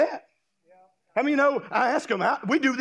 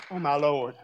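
hairdresser's dismay; 8 semitones lower than usual. Retold or imitated. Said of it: "She goes".

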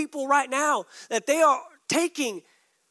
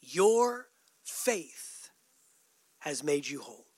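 tender; 7 semitones lower, 4 dB quieter than usual. Acted. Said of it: "Jesus responds and says".

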